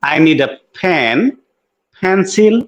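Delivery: very slow, pen and pencil with puffed ph; slow demo of aspirated p. Shown as a demonstration. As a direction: model English p slowly. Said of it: "পেন্সিল".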